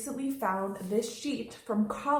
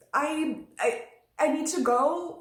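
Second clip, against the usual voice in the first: higher, louder, urgent stammer; acting burned finger; 7 semitones higher, 5 dB louder, slower than usual. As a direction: act distracted by a burnt finger, stammering to get away. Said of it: "if I just burned my finger".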